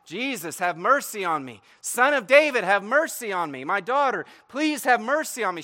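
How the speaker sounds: high, loud, repeated pleading; crying out. Acted. Woman following Jesus and disciples, crying out for mercy. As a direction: beg insistently, voice lifted over a crowd. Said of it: "And she's crying out".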